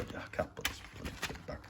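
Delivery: half-voiced grumble under the breath; annoyed mumble to himself; muttered aside. Real, not acted. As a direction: mutter it to yourself while fiddling. Frustrated aside, fumbling with case.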